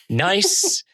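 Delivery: ordinary delivery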